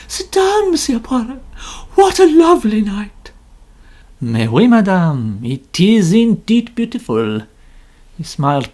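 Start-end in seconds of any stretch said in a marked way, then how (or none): 0.1-3.3 much higher, louder gushing lady novelist. A narrator's reading. Mrs Otterbourne hailing Poirot, high and gushing. 4.2-7.4 put-on slow, louder Poirot with French accent; courteous Belgian detective, unhurried. A narrator's reading. Poirot politely answering the novelist, slow French accent.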